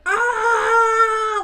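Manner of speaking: high, loud drawn-out "aah" scream; imitated scream. Retelling the neighbour's outburst to listeners, mock scream. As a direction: mimic a screaming neighbour at volume. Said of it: "scream at the top of her fucking lungs".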